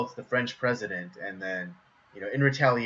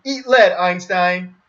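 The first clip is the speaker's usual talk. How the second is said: action-hero taunts; 7 semitones higher, 10 dB louder than usual. Retold or imitated. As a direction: punch out each taunt. Homer shooting historical figures.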